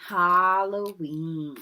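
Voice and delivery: ordinary delivery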